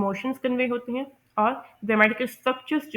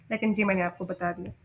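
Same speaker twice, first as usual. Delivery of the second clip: usual speaking voice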